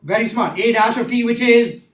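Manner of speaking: ordinary talk, nothing marked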